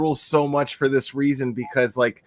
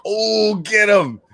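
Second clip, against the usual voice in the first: retold cheer, pitched up; mock cheering on a friend's rant; gleeful egging-on; 7 semitones higher, much slower than usual. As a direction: urge him on from the sidelines. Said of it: "I'm laughing out of, like".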